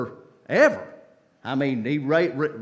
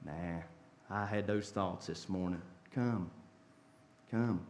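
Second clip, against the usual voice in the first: much softer and lower, near-murmured exchange; hushed dialogue; 9 semitones lower, 11 dB quieter than usual. Acted. Sinner and Jesus trading quiet lines, hushed. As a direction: bring it right down, intimate and gentle.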